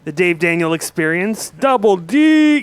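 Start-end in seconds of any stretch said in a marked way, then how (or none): 1.5-2.6 drawn-out yell, high and loud. Shown as a demonstration. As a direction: yell it like a hype man from the back. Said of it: "'Cause it's so fun to yell".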